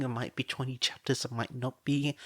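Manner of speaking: plain reading voice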